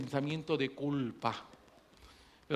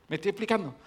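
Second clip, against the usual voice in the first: ordinary talk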